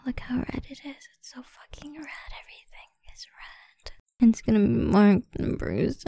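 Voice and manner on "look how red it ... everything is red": very soft, unvoiced aside; hushed whisper. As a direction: drop to a barely audible whisper. Hushed aside while admiring marks.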